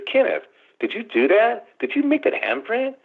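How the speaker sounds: high, startled questions at Kenneth; alarmed questioning. Retold or imitated. Adolf asking Kenneth about the handprint, startled. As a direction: demand it in shock.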